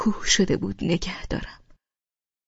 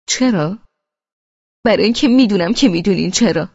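defiant retort; 8 dB louder than usual. Acted. Defiant reply in argument.

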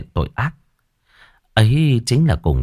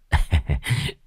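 low, soft male chuckle; short soft laugh, kept low; sly chuckle; 3 semitones lower, 5 dB quieter than usual. Acted. Killer amused by his lover behind him, chuckling.

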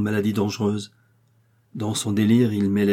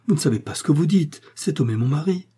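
higher, urgent protest; crying out; 6 semitones higher than usual. A narrator's reading. Millicent defends her husband to Darrell, crying out. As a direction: protest hotly in a raised pitch. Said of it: "s'écria Millicent".

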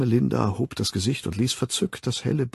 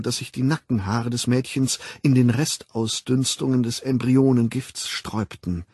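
ordinary delivery, nothing marked

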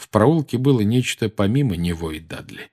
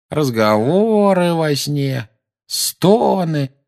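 mock moaning; 8 semitones higher, 3 dB louder, much slower than usual. Dudley mocking Harry's nightmares.

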